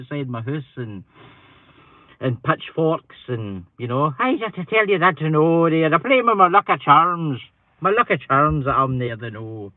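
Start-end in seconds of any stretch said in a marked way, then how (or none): 4.2-9.7 loud, hammed-up leprechaun Irish; stage-Irish outburst, raised and louder; Irish accent. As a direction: belt it out in a mock Irish accent. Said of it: "putting my Irish accent on it".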